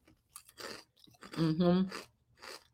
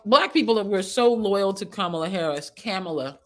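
ordinary delivery

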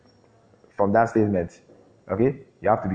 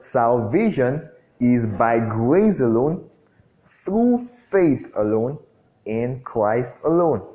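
slow emphatic creed; 3 semitones higher, much slower than usual. Shown as a demonstration. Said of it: "one thing that is clear in the Bible".

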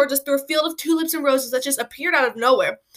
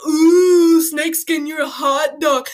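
poking fun at what annoying people say; mocking people; 2 semitones higher, 5 dB louder, much slower than usual. Annoyed kid imitating people who call him a hot dog, mock teasing. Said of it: "how people are like".

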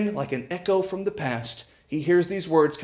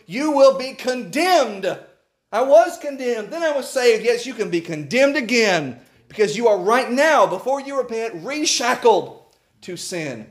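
higher, louder, driving sermon voice; full-force preaching; 8 semitones higher, 4 dB louder than usual. Acted. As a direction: preach it hard to the congregation.

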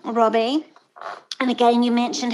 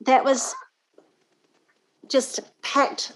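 normal talking voice

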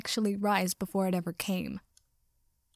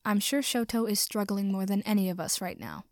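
ordinary delivery, nothing marked

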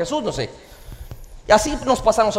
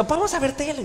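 put-on higher pleading kid voice; imitated child; 9 semitones higher, much faster than usual. Son begging his dad to watch TV, higher child voice. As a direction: ask like an eager little boy.

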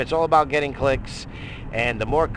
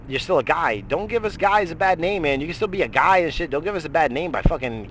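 worked-up rant; 6 semitones higher, 3 dB louder than usual. Real, not acted. Excited rant to listeners.

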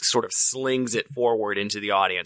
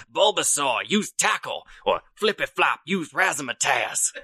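hollering commands; 6 semitones higher than usual. Retold or imitated. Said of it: "you're gonna holler".

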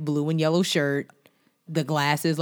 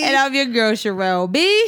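peppy host intro; 9 semitones higher, 6 dB louder than usual. Acted.

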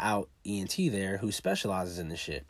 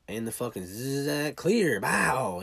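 mock zap and a louder, higher "clear, bow"; imitated defibrillator; 5 semitones higher, 4 dB louder, much slower than usual. Retelling the resuscitation to listeners, a mock defibrillator zap. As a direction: buzz it, then call clear and jolt it out.

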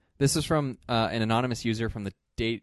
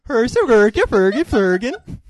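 put-on high, loud Swedish-accented gibberish; mock Swedish chef; 11 semitones higher, 13 dB louder, slower than usual. Co-host likes Swedish chefs, loud nonsense Swedish accent. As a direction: burble wildly in fake Swedish. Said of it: "accents that Swedish chefs have".

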